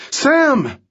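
wife screaming her husband's name; shout. Acted. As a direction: scream his name as he falls. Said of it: "Remy shouted".

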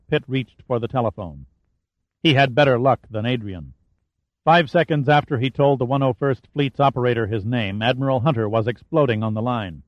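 usual speaking voice

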